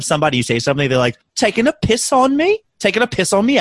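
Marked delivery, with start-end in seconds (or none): 1.3-3.6 put-on high, indignant British accent; British accent rising into a question; mock British accent. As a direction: imitate an offended Brit, pitched up. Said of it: "make fun of somebody's accent".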